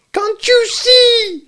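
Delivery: very high, slow, loud squeal; shouting. A narrator's reading. Piglet desperate for Kanga to recognise him, shouting. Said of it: "shouted Piglet".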